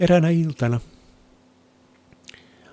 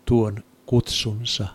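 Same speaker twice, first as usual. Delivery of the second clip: ordinary delivery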